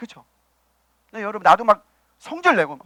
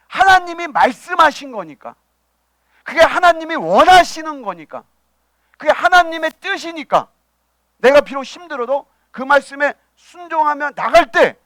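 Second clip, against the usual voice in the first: higher, forceful sermon cadence; emphatic preaching; 6 semitones higher, 4 dB louder than usual. Real, not acted. Preacher urging obedience to God's word, emphatic and raised. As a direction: press each clause home from the pulpit.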